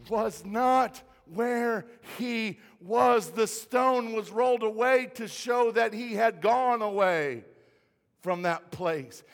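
loud, insistent sermon delivery; emphatic preaching. Real, not acted. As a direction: drive the point home to the congregation. Preaching the punch line loudly.

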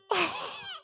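high astonished laugh; stunned disciples laughing; amazed laugh. Retold or imitated. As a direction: laugh in disbelief. Disciples amazed after the calm.

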